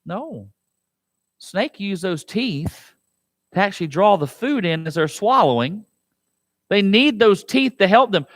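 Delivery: ordinary talk, nothing marked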